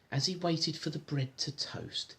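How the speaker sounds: plain reading voice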